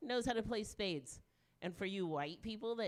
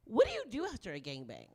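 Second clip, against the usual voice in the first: ordinary talk, nothing marked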